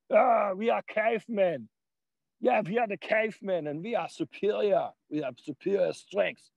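mocking neo-Nazi cavemen, high dopey caveman voice; mock caveman boast. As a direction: ham up a dim caveman bragging he is superior.

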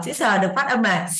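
ordinary talk, nothing marked

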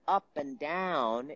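UP AND DOWN stretched in a swooping melody; slow sing-song rise and fall on "up and down"; animated prosody. Shown as a demonstration. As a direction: let "up and down" swing freely in pitch. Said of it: "is a healthy, regulated nervous system".

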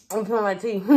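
speaking while eating with fingers; muffled by hand over lips; fingers at mouth. Real, not acted. Seen 3: Fingers pressed to lips eating, briefly lowered at 2.3–2.6.

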